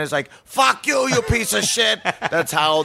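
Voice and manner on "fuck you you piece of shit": put-on Boston accent. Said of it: "the people in Boston are just so mean".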